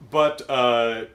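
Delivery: ordinary delivery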